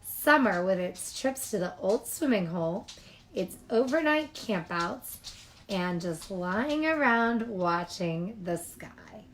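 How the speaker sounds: ordinary delivery, nothing marked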